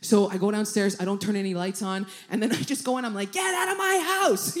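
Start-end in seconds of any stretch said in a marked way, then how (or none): 3.3-4.6 high-pitched retold shout; high, strained cry of eviction; mock yell. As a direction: order the intruder out in comic panic. Retold yelling at intruding rat.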